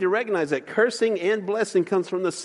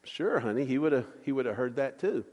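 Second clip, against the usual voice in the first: softer, lower, mildly unsure dad; less confident; 5 semitones lower, 7 dB quieter than usual. Retold or imitated. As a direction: answer the child quietly, with confidence slipping. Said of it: "not quite as confidently".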